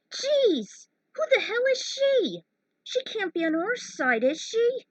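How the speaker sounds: high, loud outburst of disbelief; a pilot just shot at her train, loud and high; shocked protest. Acted. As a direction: yell it out, stunned and indignant. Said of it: "no one answered her protest".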